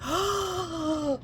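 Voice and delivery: high, hammed-up gasp; imitating panicked hospital staff; mock horrified gasp. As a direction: overplay the shock.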